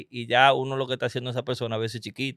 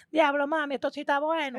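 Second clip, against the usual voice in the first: much higher, put-on squeaky voice; a squeaky would-be seducer; odd-talking guy impression; 12 semitones higher, 4 dB quieter than usual. Comedian imagines the odd-talking guy flirting, high squeaky mock voice. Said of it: "una gente que habla así".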